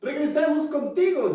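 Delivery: high, bright show host return. Pretend. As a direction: welcome viewers back on air. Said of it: "después de este comercial".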